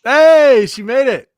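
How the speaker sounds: loud, high cheer; excited shout. Real, not acted. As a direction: call it out triumphantly. Cheering Beth coming downstairs.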